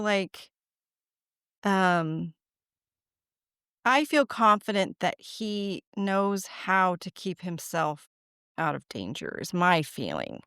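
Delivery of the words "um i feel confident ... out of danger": ordinary talk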